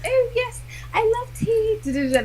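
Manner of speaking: put-on high-pitched posh English accent; mock-posh, far up in pitch. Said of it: "I put on my little posh accent".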